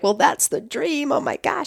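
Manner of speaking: hushed, stunned delight; overwhelmed whisper-like awe at a dream venue; awed disbelief. Retold or imitated. Retold hearing Benaroya offer.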